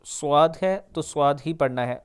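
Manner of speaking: ordinary talk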